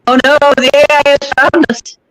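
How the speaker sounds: loud playful fake panic; mock alarm. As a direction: cry out in mock horror. Joking about AI voice glitch.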